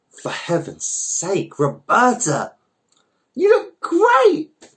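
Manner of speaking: very high, slow, drawn-out gush; gushing delight. Acted. Roberta greets Twyla at the checkout, high and gushing. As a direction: greet her like a long-lost friend.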